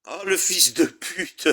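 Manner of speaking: slow swear through a laugh; laughing curse. Real, not acted. Streamer laughing at his own mistake, laughing curse. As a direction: laugh it out in disbelief.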